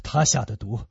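low, heavy resignation; deep, grave despair; hopeless. Acted. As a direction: pronounce that all hope is gone. Grave man says nothing can save her.

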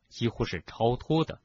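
usual speaking voice

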